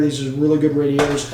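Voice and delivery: usual speaking voice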